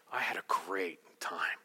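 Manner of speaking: low, slow whisper; hushed date talk. Acted. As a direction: murmur it intimately, softly and slowly. Tender end-of-date line, acted.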